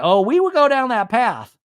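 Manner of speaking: high, loud mocking imitation of Brittany; lightly hammed-up reality star; playful high-pitched Brittany impression. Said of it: "And Brittany's like".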